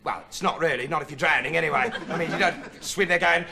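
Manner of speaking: ordinary talk, nothing marked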